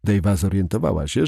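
ordinary delivery

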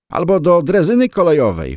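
high, loud mocking interjection; interjecting jibe. A narrator's reading. Moskvich driver joining in the mockery, teasing interjection. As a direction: butt in to top the last joke. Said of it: "wtrącił kierowca Moskwicza".

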